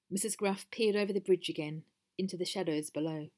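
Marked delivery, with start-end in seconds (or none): none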